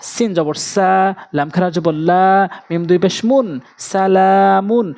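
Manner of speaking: measured chant-like build to সালামুন; joined-up spelling recitation. Shown as a demonstration. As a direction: spell slowly, then join as সালামুন with long লা. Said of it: "মিলিয়ে মিলিয়ে উচ্চারণ করব".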